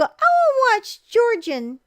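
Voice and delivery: put-on high, loud, slow whiny child; little kid voice. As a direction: whine it like a small kid. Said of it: "You always go".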